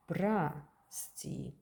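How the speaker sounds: "Прости" said prasti, O as ah, stress on E; O reduced to A. Shown as a demonstration. Teacher showing how прости is really spoken, reduced O. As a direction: say "Прости" with ah in the first syllable. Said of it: "We're writing прости, but pronounce прости".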